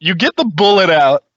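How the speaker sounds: usual speaking voice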